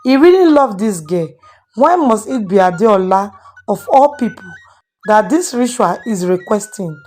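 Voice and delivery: usual speaking voice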